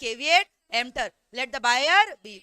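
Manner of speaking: caveat emptor, let the buyer be, said very slowly and loud; slow stressed maxim. Shown as a demonstration. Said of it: "teacher stresses the maxim". ("caveat emptor, let the buyer be" बहुत धीरे और ज़ोर से)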